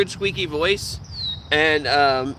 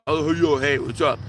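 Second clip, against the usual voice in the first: put-on deeper old voice; 3 semitones lower than usual. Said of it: "but it was deeper".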